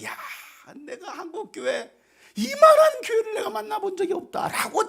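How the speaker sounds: very high, strained exclamation of wonder; marvelling praise. Acted. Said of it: "칭찬받을 수 있는 사람". (높은 목소리로 감탄하며 칭찬)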